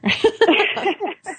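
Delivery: high bright laugh; a short giggling laugh; high giggle. Real, not acted. Laughing after tea remark.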